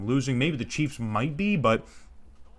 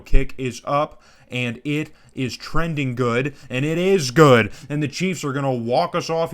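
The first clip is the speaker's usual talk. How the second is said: louder, drawn-out "it is good" call; excited call; 5 dB louder than usual. Real, not acted. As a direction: call the winning kick like an announcer. Game-winning kick play-by-play.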